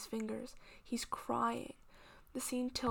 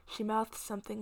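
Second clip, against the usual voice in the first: ordinary delivery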